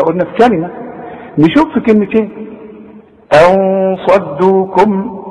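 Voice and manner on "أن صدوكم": slow chanted recitation with nasal ikhfa; tajweed recitation. Shown as a demonstration. Teacher demonstrating ikhfa to students, slow melodic recitation. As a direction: chant أن صدوكم slowly, hiding the نون before the صاد with ghunna.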